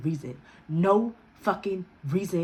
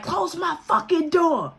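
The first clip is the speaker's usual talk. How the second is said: mother yelling; 9 semitones higher, slower than usual. Retold or imitated. Stingy's mother yells from inside.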